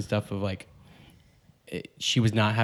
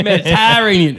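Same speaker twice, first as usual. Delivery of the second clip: put-on West Virginia accent; 8 semitones higher, 8 dB louder than usual. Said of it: "West Virginia accent".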